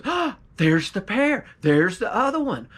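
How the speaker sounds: gasp, then exclaim as if thrilled by the find; excited discovery. A narrator's reading. Farmer spots the second shoe.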